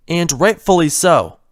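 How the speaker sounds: ordinary delivery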